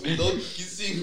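laughing through a mock movie line; giggling villain line. Finishing the villain quote.

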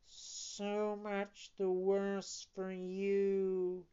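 slow, drawn-out cat echo. Acted. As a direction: drag it out like a lazy sly cat. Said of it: "repeated the cat".

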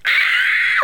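very high shriek; little girl scream. Shown as a demonstration. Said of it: "a little girl scream".

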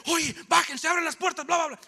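high and loud, shouting thugs; retold shouting. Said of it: "salieron gritando". (gritando fuerte como los asaltantes)

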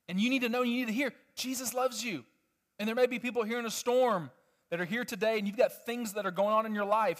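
ordinary talk, nothing marked

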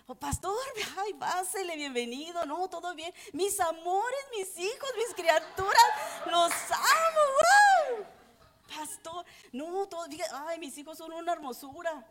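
high, gushing put-on sweetness; fake sweet welcome. Mother greeting the pastor, suddenly gushing and sugary high. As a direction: pour on the syrupy charm for show.

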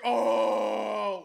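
long, loud "oh"; imitated crowd reaction. Onlookers reacting to the coach's put-down, loud drawn-out oh. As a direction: mimic a crowd's drawn-out stunned oooh. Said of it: "Everybody's like".

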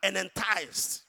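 loud, slow preached scripture reading; scripture preaching. Acted. Preacher reading James to congregation, loud and slow. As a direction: proclaim each verse slowly.

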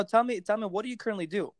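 ordinary talk

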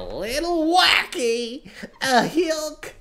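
high, loud, drawn-out hype; big goofy hype-up shout; amped-up energy. Pretend. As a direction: amp it up wacky, stretching the words. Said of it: "trying to amp up the energy".

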